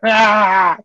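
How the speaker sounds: sudden high, loud mock scream. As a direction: yell like a man bolting out of the hospital. Character bursts out screaming.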